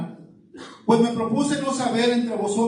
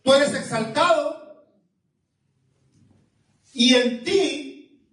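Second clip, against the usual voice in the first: rising fervor; 6 semitones higher than usual. Real, not acted. Preacher exalting the congregation. (exaltación creciente, lenta y aguda)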